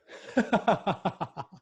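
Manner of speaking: a light laugh breaking the tension. Real, not acted. Host laughs after the heavy story, high and light.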